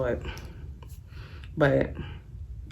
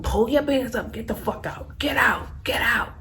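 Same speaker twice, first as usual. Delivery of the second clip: retold angry ousting; 8 semitones higher than usual. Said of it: "I started pushing him".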